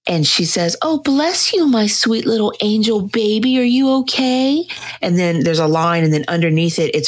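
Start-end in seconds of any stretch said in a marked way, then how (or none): 0.8-4.6 high, cooing pet voice; doting baby talk. Retold or imitated. Woman to her sneezing dog in a meme, high doting coo.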